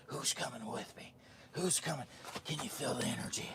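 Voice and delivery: unvoiced whisper, urgent and fast; whispered hype. Vlogger whispering hype to viewers, whisper. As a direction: hiss the hype like a secret.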